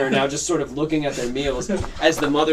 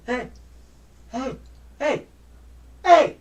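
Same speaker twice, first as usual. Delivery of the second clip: repeated calling; 11 semitones higher, slower than usual. Acted. Teenagers call out to Gore.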